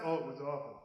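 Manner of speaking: usual speaking voice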